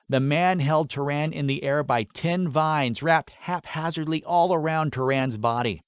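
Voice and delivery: usual speaking voice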